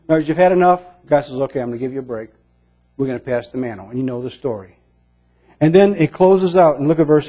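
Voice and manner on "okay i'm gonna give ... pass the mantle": gentle reassurance. Acted. God gently relieves Elijah.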